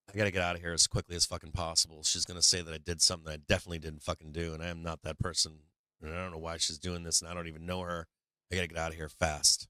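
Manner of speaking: low, fast, rattled private thoughts; panicked inner voice. Retold or imitated. Man thinking his date is crazy, low racing panic. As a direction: rush it low under your breath like a spooked mind. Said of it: "in my mind, I go".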